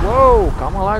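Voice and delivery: loud, high woah, easy there; startled shout. Real, not acted. Gamer surrounded by enemies, startled loud shout. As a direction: yell at the crowd to back off.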